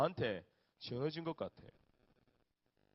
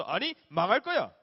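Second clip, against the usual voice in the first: stern prophet; 5 semitones higher, 10 dB louder than usual. Retold or imitated. Isaiah voiced in story.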